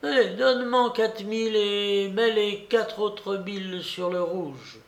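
put-on high-pitched old lady's order; eager, imperious grandmother; excited old babouchka, commanding. A narrator's reading.

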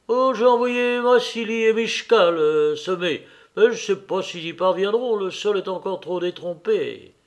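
hedging steward; steward making excuses. Acted. Voices the intendant in dialogue.

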